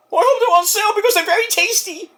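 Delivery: high, put-on goofy old-wrestler voice; Mick Foley impression. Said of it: "What do you think about that, Mick".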